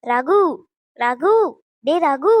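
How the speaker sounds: loud repeated call for Ragu; loud calls of Ragu's name; calling out loudly. Acted. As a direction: summon him from another room. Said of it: "காட்டு கத்து கத்திக்கிட்டு".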